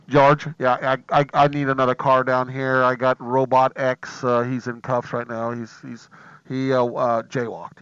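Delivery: low police radio voice; cop on the radio. Pretend. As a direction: call it in like a cop. Imitating cop calling on radio.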